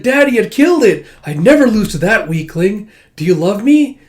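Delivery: put-on high, loud little-girl voice; excited child boasting. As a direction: boast eagerly to win a father's love. Child boasts to father, seeking love.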